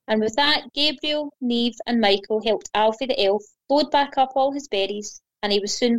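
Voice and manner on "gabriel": plain reading voice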